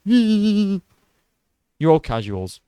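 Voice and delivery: high, drawn-out mocking voice; mock fan whine. YouTuber imitating fans dismissing others, high slow mockery. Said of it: "And then you go".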